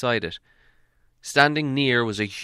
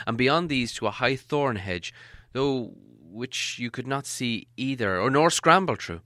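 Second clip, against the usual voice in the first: ordinary delivery, nothing marked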